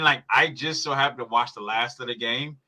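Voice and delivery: ordinary talk, nothing marked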